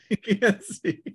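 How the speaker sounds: laughing through the words. Real, not acted. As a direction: chuckle while you tease. GM laughs at blinded player.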